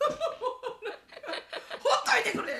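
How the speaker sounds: high, loud leave-me-alone; laughing exasperation. Retold or imitated. Mother tells the meddling mom off, loud and high. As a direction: groan it out, half laughing. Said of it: "みたいな".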